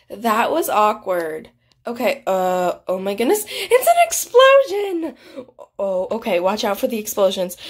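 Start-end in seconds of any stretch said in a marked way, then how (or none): 3.5-5.1 high, loud, drawn-out cry of alarm; alarmed exclamation. Real, not acted. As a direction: call out the danger, pitched high. Explosion in the game.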